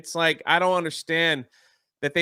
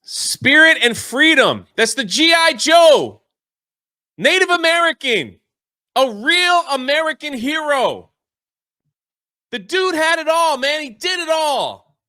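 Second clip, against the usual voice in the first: excited shouting; 6 semitones higher, 8 dB louder than usual. Real, not acted. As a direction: boom it out in delight. Enthusing about the G.I. Joe.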